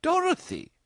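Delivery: high, scandalised cry of the name; shocked mother. A narrator's reading. Mother hears her daughter may marry him, shocked.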